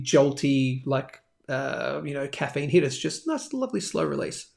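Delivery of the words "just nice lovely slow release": soft, hushed, savouring tone; soothing. Pretend. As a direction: ease into it softly, like gentle relief. Describing a gentle caffeine release.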